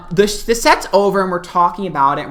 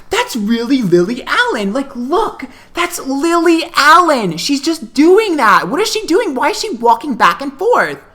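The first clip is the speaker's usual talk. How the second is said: high-pitched, incredulous loud outburst; loud disbelief; 8 semitones higher, 4 dB louder than usual. Retold or imitated. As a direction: imitate a loud cousin causing a scene. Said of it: "And she's loud".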